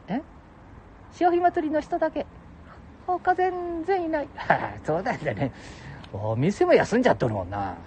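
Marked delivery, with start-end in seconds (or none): 1.1-4.3 much higher put-on voice; falsetto second voice. Companion adds who was there, high falsetto. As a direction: chirp it in falsetto.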